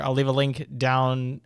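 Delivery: usual speaking voice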